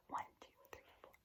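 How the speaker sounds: counting under the breath; whispered counting. Real, not acted. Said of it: "counting in whisper".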